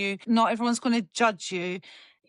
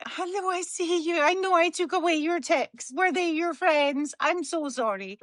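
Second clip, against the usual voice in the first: higher sing-song voice to the dog; talking to the dog; 6 semitones higher than usual. Real, not acted. Talking to the dog mid-podcast.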